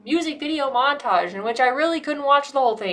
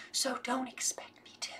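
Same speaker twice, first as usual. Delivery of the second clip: whisper; 14 dB quieter than usual. Real, not acted. Confiding aside to viewers.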